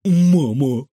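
frightened squeak. A narrator's reading. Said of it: "пискнул Кефир". (испуганно пискнуть)

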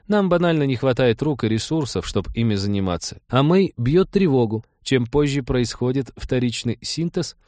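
earnest, apologetic female character; earnest Trixie. Acted. Voices Trixie explaining.

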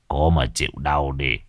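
put-on low old sorcerer voice; old master speaking. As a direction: talk down to the patient. Old master scolds patient.

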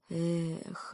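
plain reading voice

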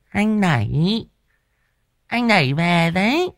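high, bashful sing-song call; coy ghost girl. Acted. Coy ghost girl greets him.

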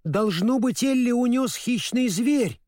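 high-pitched, distressed scarecrow; sorrowful. A narrator's reading. Scarecrow guessing a beast took Ellie, sorrowful. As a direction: lament it in a high character voice. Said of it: "горестно спросил Страшила".

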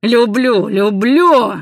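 loud, high, anguished shout; anguished, drawn-out yell; wounded-animal cry. A narrator's reading. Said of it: "кричал, как раненый зверь".

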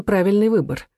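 usual speaking voice

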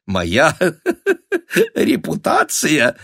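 high laugh breaking up the phrase; incredulous words squeezed through a guffaw; burst of laughter. A narrator's reading. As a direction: crack up mid-phrase. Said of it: "хохотнула".

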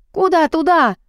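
громкий высокий крик, удар на «куда»; заори изо всех сил; ору что есть сил. A narrator's reading. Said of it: "Ору я, что есть сил". (в истерике орёт на Рената, выше и громче)

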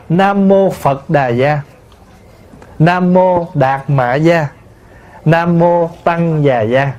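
slow, measured Phật Đà Gia, Đạt Mạ Gia, Tăng Già Gia; Vietnamese transliteration. Shown as a demonstration. Monk shows the familiar chant transliteration, slow Vietnamese pronunciation. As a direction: recite Phật Đà Gia in separate Vietnamese syllables. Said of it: "mà mình phiên âm lại là".

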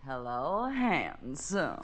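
low sultry breathy greeting; breathy vintage purr. Acted. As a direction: purr it like a vamp. Sexy dame greets from closet.

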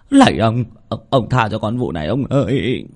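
high, pleading, stuttering servant; stammering in fear. Acted. Terrified servant stammers, begging the master to spare him. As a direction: beg with a trembling stammer. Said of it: "Thằng Tý lắp bắp".

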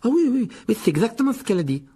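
high, loud rush to back her up; overeager agreement. A narrator's reading. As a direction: agree too readily, pitched right up. Les garçons confirment sous le coude. (acquiescement empressé, voix aiguë)

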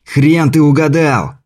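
slow defiant shout. Acted. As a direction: yell it after him. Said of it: "крикнул Кобельков".

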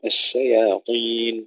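الشياطين with light shin and ya and a heavy emphatic ṭa; thin الشي, thick طين; correct pronunciation. Shown as a demonstration. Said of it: "ترقيق الشين والياء وتفخيم الطاء". (ترقيق الشين وتفخيم الطاء في الشياطين)

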